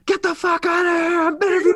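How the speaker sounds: high-pitched hammed-up mock shout; mock yelling. Teasing co-host for his tirade at ice cream truck, mock yelling. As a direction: yell it as an over-the-top imitation. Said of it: "You're just yelling".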